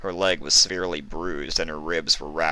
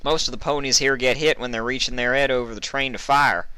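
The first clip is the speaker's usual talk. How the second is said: Applejack voice; 6 semitones higher than usual. A narrator's reading.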